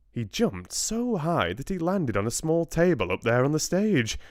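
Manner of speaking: usual speaking voice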